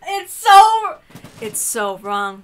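high, loud, slow cry of dismay; shrieking in disbelief; horrified outburst. Real, not acted. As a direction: squeal in mock horror. Horrified reaction to 'Daddy'.

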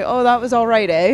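self-mocking Canadian habit. Shown as a demonstration. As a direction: show off your Canadian "eh". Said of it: "I'm notorious for the A".

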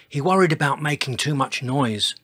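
plain reading voice

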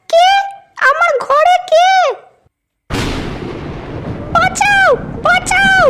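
very high put-on girl's voice, shrieking; screaming for help. (বিছানায় অচেনা লোক দেখে মেয়ের খুব উঁচু আর্ত চিৎকার)

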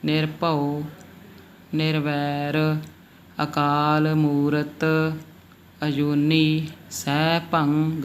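very slow scripture words with long vowels; drawn-out recitation. Shown as a demonstration. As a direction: stretch each word out for learners. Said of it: "ਥੋੜ੍ਹਾ ਲੰਮਾ ਲਿਜਾਣਾ ਪੈਂਦਾ ਹੈ".

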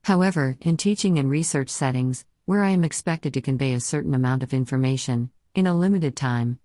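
synthesized text-to-speech voice; computer-generated voice. Real, not acted. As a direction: speak like a computer voice reading text. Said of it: "I use a computer-generated voice, as you can hear now".

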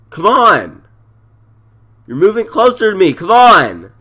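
loud, high pleading yells; man down shout. Real, not acted. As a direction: shout at the teammate who won't come. Medic still ignoring him.

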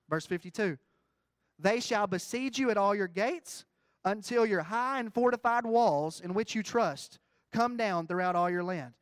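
loud, slow, rising proclamation; slow preached scripture. Acted. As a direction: stretch out afar and let it ring. Reads prophecy.

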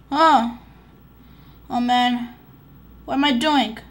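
ordinary talk

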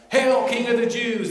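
loud, high mock salute; hammed-up taunting proclamation. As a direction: jeer it out like a crowd hailing a fake king. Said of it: "as they're mocking him".

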